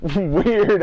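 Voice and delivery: high, loud, laughing exclamation; amused disbelief. Retold or imitated. Friend recalls mom's odd back-door habit, amused disbelief.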